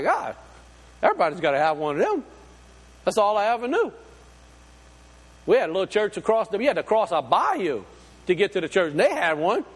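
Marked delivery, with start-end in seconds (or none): none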